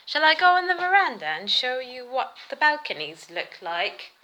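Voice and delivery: casual put-on British accent. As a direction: play the posh British host with a British accent. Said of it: "I don't know why I'm using a British accent".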